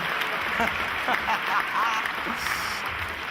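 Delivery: long airy unvoiced laugh; breathy laugh. Real, not acted. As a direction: laugh helplessly without voice. Reacts to lost-voice sign.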